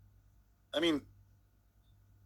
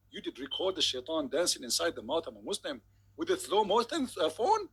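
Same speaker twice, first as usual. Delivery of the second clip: usual speaking voice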